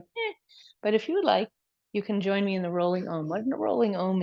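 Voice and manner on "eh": meh. Retold or imitated. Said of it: "It feels kind of like".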